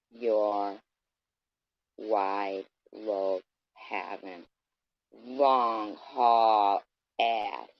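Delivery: very slow, stretched words; dragged-out insult. Real, not acted. Vlogger drags out insult to the woman, very slow. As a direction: draw every word out with contempt.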